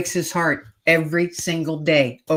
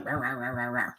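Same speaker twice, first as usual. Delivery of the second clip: put-on much softer muttered rah rah of a cranky old man; mumbled grumbling; 4 semitones lower, 9 dB quieter than usual. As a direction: grumble it under your breath, wordless. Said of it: "he was mumbling and grumbling".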